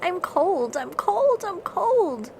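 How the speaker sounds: high, shaky shivering cry. A narrator's reading. Lemming shivering loudly in the cold. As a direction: teeth chattering, chilled to the bone. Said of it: "shaking loudly".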